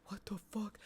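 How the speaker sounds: breath-only, baffled whisper; bewildered whisper. Retold or imitated. Streamer reliving the thumping starting up, bewildered whisper. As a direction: whisper it in disbelief. Retold bewildered reaction to noise.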